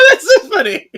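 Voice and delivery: high, loud laughing line. Retold or imitated. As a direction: laugh through it at the joke. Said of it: "Kira's like".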